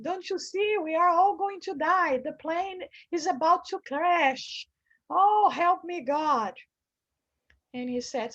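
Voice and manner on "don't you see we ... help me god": higher, loud fearful insistence; panicked pleading. Retold or imitated. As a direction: cry out that the plane will crash. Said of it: "And he started talking to him".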